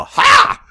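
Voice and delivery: very high, loud mock martial-arts cry; sharp put-on karate "ha"; kung fu yell. Comic facing three guys in karate outfit, mock kung fu yell. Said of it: "Like Kung Fu-y, you know, remember Hong Kong Fooey?".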